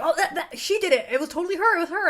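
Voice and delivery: high, loud, insistent accusation; imitated accuser. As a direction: blurt out blame as an excited witness. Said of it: "and they're like".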